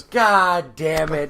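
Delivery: loud, long-drawn mock curse; exasperated group groan. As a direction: act out a whole crowd's dismay. Crowd's collective dismay.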